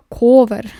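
loud laughing repeat of "Ковер". Real, not acted. Rereading odd word, laughing.

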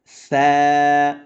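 "সা" as th, tongue between the teeth; tongue-out tha. Shown as a demonstration. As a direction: push the tongue out and hold "সা" as tha. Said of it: "জিহ্বা বের করে আপনি হরফটা উচ্চারণ করেন".

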